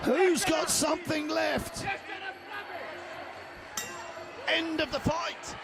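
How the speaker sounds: energetic live fight call; play-by-play commentary. Real, not acted.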